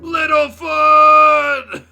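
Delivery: long, high, very loud wail; hammed-up scream. Sarah screaming for dead Littlefoot, hammed up. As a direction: scream the name out across the canyon. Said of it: "Her scream sent an echo".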